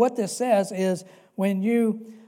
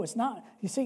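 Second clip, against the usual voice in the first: ordinary talk, nothing marked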